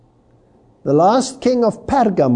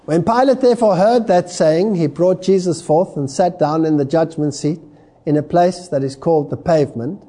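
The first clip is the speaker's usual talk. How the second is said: ordinary talk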